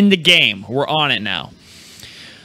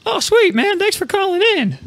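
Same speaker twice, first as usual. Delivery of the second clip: mock excited squeal. Host reacting to odd caller.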